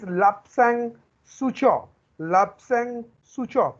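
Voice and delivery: Lapsang Souchong repeated slowly, each word set apart; pronunciation drill. Shown as a demonstration. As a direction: lead a class in saying "Lapsang Souchong" slowly. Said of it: "Please pronounce it with me". ("Lapsang Souchong" का धीमा अभ्यास, साथ बोलने के लिए)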